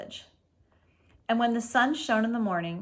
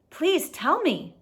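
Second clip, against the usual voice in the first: pleading; 8 semitones higher, 5 dB louder, slower than usual. Acted. Ba begging the fish.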